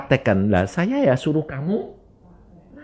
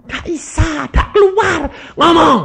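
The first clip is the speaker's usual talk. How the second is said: much higher, strained complaining woman; woman's protest; 7 dB louder than usual. Retold or imitated. Mute woman protests to preacher, high and strained. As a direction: squeeze out a helpless high protest.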